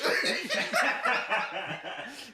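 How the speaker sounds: loud, high belly laugh; teasing laughter. Real, not acted. Friend laughing after teasing him, loud cackle. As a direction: crack up at your own jab.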